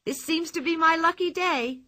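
high, delighted cry of luck; triumphant, high-voiced little girl. A narrator's reading. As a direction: crow it happily, pitched well up. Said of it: "triumphant cry from Pippi".

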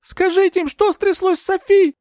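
very high, loud shout of appeal; high-pitched, desperate outcry; wailing cry. A narrator's reading. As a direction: yell for her to tell them. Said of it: "завопила я".

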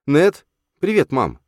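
put-on high, loud young boy calling; boy greeting mum; child voice. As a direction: call out brightly like a small boy. Child Ned greets his mother.

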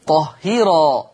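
slow "طهيرا" with a light ra; thin ra. Shown as a demonstration. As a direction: recite "طهيرا" with the ra softened. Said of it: "هذه الراء مفتوحة". (ترقيق الراء في "طهيرا")

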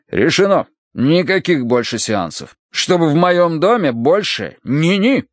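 emphatic, slow decree; firm prohibition. Acted. Colonel forbids seances in his house, emphatic. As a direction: lay down the law.

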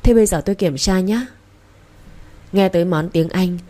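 usual speaking voice

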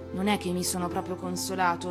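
usual speaking voice

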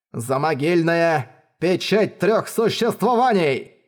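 slow, sinister chanted spell name; ominous incantation. A narrator's reading. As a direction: intone it like a dark curse. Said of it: "зловещий голос практика без маски".

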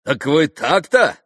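loud, high challenge; affronted exclamation. A narrator's reading. Mitya challenging the gentlemen who won't drink, affronted. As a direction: challenge them, drawn out and loud. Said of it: "воскликнул Митя".

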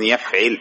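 يفعل said yaf'il, with an i after the ع; يفعل as "YAF-'il", short i in the last syllable; middle vowel i. Shown as a demonstration. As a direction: say يفعل with kasra on the ع. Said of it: "ما مضارعه على وزن".

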